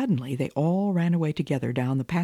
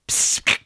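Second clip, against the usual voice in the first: sharp hissing cat spit; breathy feline hiss. Acted. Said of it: "spit and growled at the tailor".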